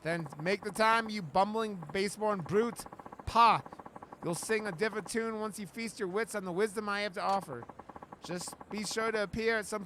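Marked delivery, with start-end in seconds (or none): 3.2-3.6 loud scoffing pah; a loud, disdainful noble's huff; haughty scoff. Acted.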